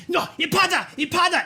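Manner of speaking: desperate high cry at contained volume; acted screaming. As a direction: cry out the name in high, hammed-up panic. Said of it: "he just starts screaming".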